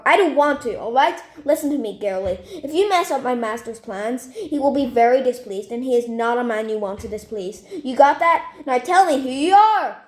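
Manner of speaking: bully her over the phone; menacing threat. Acted. Caller threatening Stephanie, loud menacing demand.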